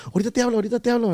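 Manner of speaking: high, hurried mock voice of his wife; imitating panicked wife. Wife rushing off to the office, high hurried voice. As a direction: voice his wife brushing him off, high and rushed.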